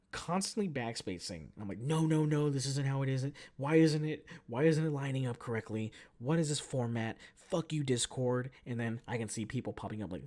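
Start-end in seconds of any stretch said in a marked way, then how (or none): 1.8-8.5 loud, panicky frustrated ranting; retold freak-out. Typist fighting Discord formatting, frantic and cross. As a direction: rant louder as if the typing keeps going wrong. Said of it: "I'm freaking out".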